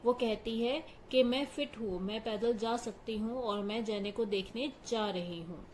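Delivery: ordinary talk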